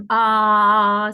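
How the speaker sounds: long, loud, steady "Ah"; sustained vowel. Shown as a demonstration. Teacher showing that vowels vibrate, long loud held vowel. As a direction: hold "Ah" loudly so the vocal cords buzz. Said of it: "Cuando yo digo a".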